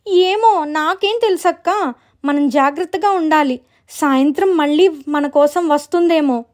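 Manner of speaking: high, fretful character voice; acted anxious girl; worried little sister. Sisters fretting about ghost.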